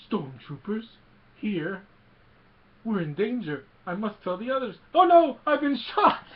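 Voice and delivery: high, loud fussy droid in a panic; hammed-up robot alarm, rising to a yelp; C three P O. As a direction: play the panicking protocol droid, building to a shriek. Imitating C three P O's alarm.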